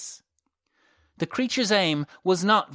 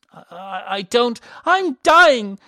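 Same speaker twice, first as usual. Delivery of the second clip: high, loud stammering cry; panicked shout; 10 semitones higher, 9 dB louder than usual. A narrator's reading. Elisa crying out to Malern in panic. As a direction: stumble over it in terror. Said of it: "shouted Elisa".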